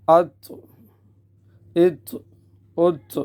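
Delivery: echoing release on each emphatic t; qalqalah on taa. Shown as a demonstration. Teacher shows qalqalah letters, bouncing the heavy t. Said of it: "قلقلہ کیا جائے گا".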